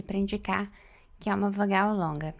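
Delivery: ordinary delivery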